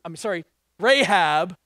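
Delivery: ordinary talk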